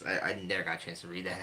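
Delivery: normal talking voice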